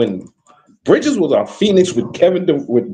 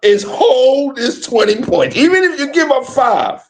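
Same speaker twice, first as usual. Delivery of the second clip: imitating the coach; 7 semitones higher than usual. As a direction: mimic a coach's pep talk to the team. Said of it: "I can bet you said this".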